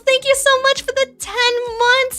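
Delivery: hammed-up thanks. Thanking a subscriber in high hammed voice.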